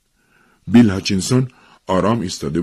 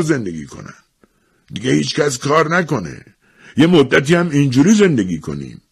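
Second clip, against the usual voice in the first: put-on old man's indignant grumble, pitched up; mildly growled scolding; grumbling growl; 9 semitones higher, 4 dB louder than usual. A narrator's reading. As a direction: grumble crossly, higher than the narration. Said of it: "وارنر پیر غرید".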